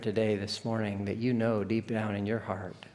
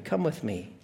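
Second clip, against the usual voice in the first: usual speaking voice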